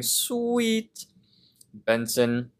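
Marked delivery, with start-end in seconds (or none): 0.0-1.0 much higher voice, pleased; high squeaky sweet. Retold or imitated. Rigby agreeing with Mordecai, in a high mock voice. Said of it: "agrees, saying".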